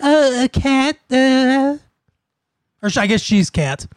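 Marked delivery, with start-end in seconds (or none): 0.0-1.8 put-on baby voice. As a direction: imitate a ditzy baby voice. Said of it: "She's like".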